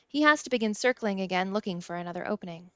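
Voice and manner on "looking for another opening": ordinary delivery, nothing marked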